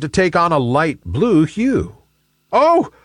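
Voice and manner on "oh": high, panicked cloud voice; acted squeal of fear; frightened cry. A narrator's reading. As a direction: cry out in alarm, very high, then rush the question. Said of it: "cried Nimbus in fear".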